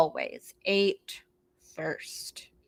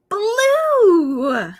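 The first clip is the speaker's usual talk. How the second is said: high, loud, drawn out blue; excited kitten; 11 semitones higher, 8 dB louder than usual. Acted. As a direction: squeal the colour with delight. Kitten names its favourite colour.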